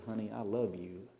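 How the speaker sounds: soft, tender murmur. Retold or imitated. Husband murmurs to his wife in the kitchen, tender and soft. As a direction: whisper it sweetly in her ear. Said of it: "nibbles on your ear".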